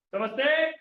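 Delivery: usual speaking voice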